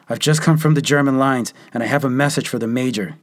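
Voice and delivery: captured private calling to hidden troops, urgent call. A narrator's reading. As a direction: shout toward an unseen voice. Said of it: "managed to call out".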